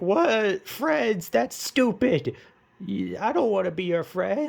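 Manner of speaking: high, mocking villain sneer; hammed-up villain scoffing. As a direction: brush off the offer with a scoff.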